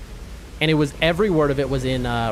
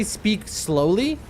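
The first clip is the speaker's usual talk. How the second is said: said slowly; 4 semitones higher, much slower than usual. Shown as a demonstration. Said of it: "And I know how to say".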